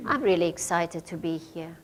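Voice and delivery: deadpan claim of excitement; unconvincing excitement. Shown as a demonstration. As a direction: say you're thrilled with no excitement at all. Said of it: "are you gonna believe me?".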